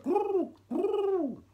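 high mock growl. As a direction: growl like a warning signal. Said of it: "Harriet was like".

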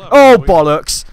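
high, loud startled outburst; real fright, loud and high; startled swear. Real, not acted. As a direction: blurt it out, caught by a jump scare. Jump scare in sewer.

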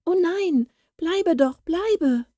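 startled cry. A narrator's reading. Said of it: "rief Waldhilde erschrocken".

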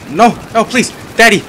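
high, loud panicked begging; pleading yell. Real, not acted. Gamer yelling at the game in high panic. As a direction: beg in a high loud yelp. I did not yell out, "Daddy.

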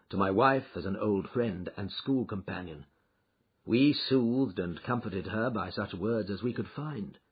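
ordinary delivery, nothing marked

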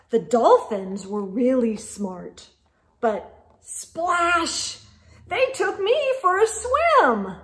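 put-on lively read-aloud for kids; animated storybook reading; story narration. A narrator's reading. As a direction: read it like a picture book.

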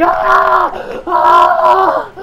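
two loud high screams; mock scream. Acts out a character screaming.

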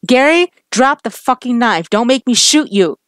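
loud, high command; shouted order. Acted. Cop shouting at armed partner.

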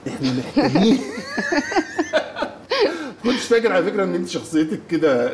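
talking through laughter; laughing. Real, not acted. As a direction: laugh as you start the remark. Host laughing while talking. (بيتكلم وهو بيضحك)

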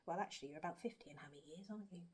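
quick soft whisper to a pet. Real, not acted. Teasing the hamster about her age, in a quick whisper.

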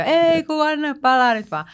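slow, high mock drunk reply; drunk audience voice. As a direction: play the tipsy crowd waving it off. (leikitelty humalainen yleisö, hidas ja korkea)

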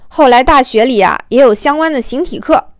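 ordinary delivery

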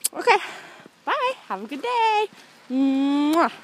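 high, lisping cartoon duck voice; Daffy Duck voice. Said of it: "hear my Daffy Duck voice".

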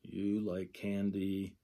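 "You like candy" said slowly, word by word; slow gloss reading. Shown as a demonstration. Teacher showing students the gloss, slowly, word by word. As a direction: read it like a sign gloss, one sign at a time. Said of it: "That's how you would gloss it".

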